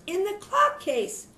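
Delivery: put-on high, timid little kid's reply; small scared voice. Youngest kid answering mother from the clock case. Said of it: "in his soft, scared voice".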